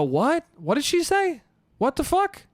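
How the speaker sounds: high-pitched incredulous outburst; confused, voice shooting way up; baffled, out loud. Retold or imitated. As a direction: blurt it out in total disbelief. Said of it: "Out loud like that".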